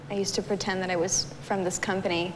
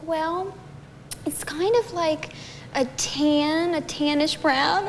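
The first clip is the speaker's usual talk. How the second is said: higher, slow, musing old lady imitation; old woman on the phone; 8 semitones higher, much slower than usual. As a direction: drag it out like a sweet old lady. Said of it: "she's like".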